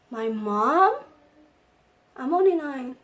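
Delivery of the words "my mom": loud, disbelieving question rising at the end; incredulous. Retold or imitated. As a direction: blurt it out as a stunned question. Child hears mother is coming.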